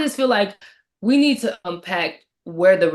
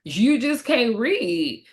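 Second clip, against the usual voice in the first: very slow, raised-pitch teasing repeat; drawn-out put-down; 4 semitones higher, much slower than usual. Real, not acted. Repeating the jab at the disrespectful girl, drawn-out put-down. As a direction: stretch every word to rub it in.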